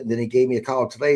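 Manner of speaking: ordinary delivery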